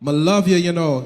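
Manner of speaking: low mock Jamaican come-on; Jamaican accent. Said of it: "He's on her like".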